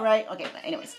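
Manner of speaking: ordinary talk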